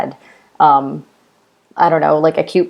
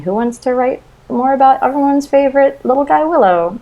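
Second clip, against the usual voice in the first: mock Lucas pitch; 9 semitones higher than usual. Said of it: "putting out feelers and saying".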